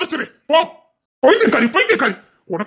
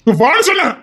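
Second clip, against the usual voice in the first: higher, louder order; raised-voice order to come; loud command; 5 semitones higher, 4 dB louder than usual. Acted. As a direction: bark it out now.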